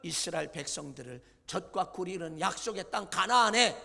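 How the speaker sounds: a loud solemn sentence; stern verdict. Acted. God sentencing Moses, loud and stern. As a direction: pronounce judgement. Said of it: "세 번째는 뭐라고 하면".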